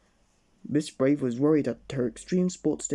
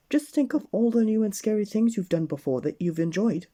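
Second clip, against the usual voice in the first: high, louder coaxing voice; encouraging; 7 semitones higher, 4 dB louder than usual. Acted. Miss Brave encouraging nervous friends, high and coaxing. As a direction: cheer the friends on kindly. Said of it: "asked Miss Brave".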